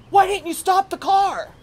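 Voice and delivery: high, loud scolding question; father demanding angrily why; angry father. Retold or imitated. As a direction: snap at her like an angry dad.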